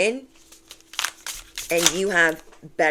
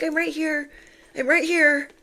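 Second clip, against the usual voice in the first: eager calling out; 11 semitones higher than usual. Retold or imitated. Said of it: "You're like".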